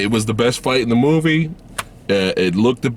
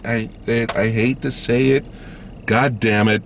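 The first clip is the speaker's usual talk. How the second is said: ordinary talk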